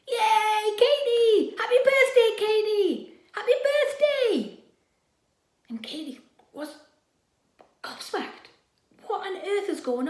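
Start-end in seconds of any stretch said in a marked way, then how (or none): 0.1-4.6 high, loud cheer; cheering crowd. Acted.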